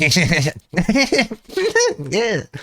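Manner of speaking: high wordless giddy chuckle; imitated giggle. Imitating a guy nodding at tram passengers, giddy giggle. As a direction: mimic someone giggling in little bursts. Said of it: "nodding at people and he's like".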